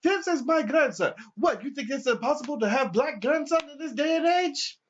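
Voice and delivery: high, loud offended grandpa; the old man challenging the cop, high and loud; indignant old man. Retold or imitated. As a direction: sound scandalised and high. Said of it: "he's just looking like".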